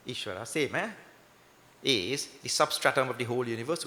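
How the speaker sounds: normal talking voice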